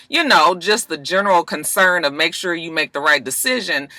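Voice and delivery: ordinary delivery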